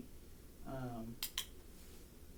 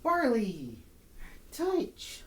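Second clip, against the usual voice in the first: high dog call; 9 semitones higher, much slower than usual. Real, not acted. Calling dog to do touch.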